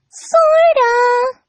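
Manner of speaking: high and coy; sly high Sorta. A narrator's reading. Pinkie hiding the drugged cupcake from Dash, coy and high. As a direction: tease it, hiding the secret.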